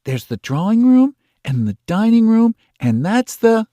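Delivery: put-on excited little girl pointing out rooms; proud showing off. A narrator's reading. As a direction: show off each room eagerly. Kezia proudly showing dolls house.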